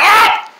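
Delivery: short, high yell; frustrated scream. Acted. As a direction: burst out screaming to cut off the bickering. Pants loses patience.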